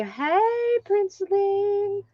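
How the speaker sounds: put-on high, slow baby voice. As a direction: voice the baby's greeting, high and slow. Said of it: "gotta display and say".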